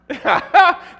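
loud high laugh; burst of laughter. Real, not acted. Pastor laughing at his own story, loud laugh. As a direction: crack up out loud.

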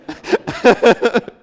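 loud high laugh at his own joke; burst of laughter. Real, not acted. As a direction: laugh out at the absurdity.

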